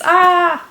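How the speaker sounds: high, loud groan of frustration; frustrated cry. Real, not acted. Vlogger finding her script too short, frustrated cry.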